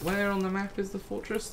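ordinary delivery

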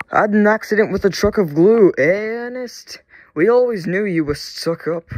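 loud, high teasing jeer; crowing at a rival's mishap; taunting. A narrator's reading. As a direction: rub it in with mock concern. Passing engine taunts the glued Ernest.

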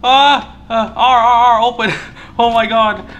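high, loud panicked calling out. Real, not acted. Player urges escape during a chase, frantic. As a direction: build from tense to frantic shouting. Fleeing in game, shouts run.

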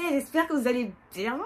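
mock your own over-lively greeting, stretching the ends; exaggerated self-imitation. Imitating how she sometimes greets viewers, exaggerated and lively. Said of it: "quand je dis bonjour".

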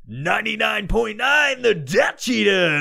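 slow, loud, high station announcer; radio promo voice. Shown as a demonstration. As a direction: belt it out like an FM ident. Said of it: "like, a promo for the fucking podcast".

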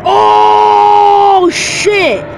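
shouting. Real, not acted. Hype moment in match.

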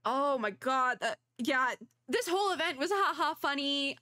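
usual speaking voice

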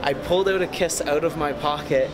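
usual speaking voice

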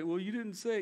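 lightly voiced whiny child; a hint of a protesting kid; kid's excuse. Child's excuse to parent, acted.

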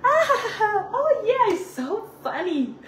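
mock the polite fake laughter at bad jokes; high, phony English "it's so funny". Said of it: "Nunca fui tan falsa".

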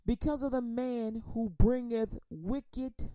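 solemn slow Psalm reading; slow scripture reading. Acted. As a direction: read each word slowly so it sinks in. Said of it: "I'm gonna read it slow".

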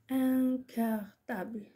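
slow, evenly drawn-out dictation. Shown as a demonstration. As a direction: dictate slowly for pupils writing it down. Dictating words for pupils to write. (إملاء بتأنٍّ وببطء)

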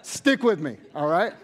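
laughing, loud, pleading with the crowd. Real, not acted. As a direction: laugh it off and beg them to stay. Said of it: "laughing at crowd reaction".

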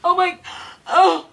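choking and gasping loudly; loud coughing gasps; coughing fit. Real, not acted. Just swallowed spoonful of cinnamon.

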